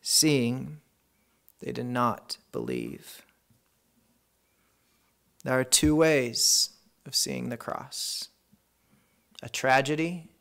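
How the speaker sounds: usual speaking voice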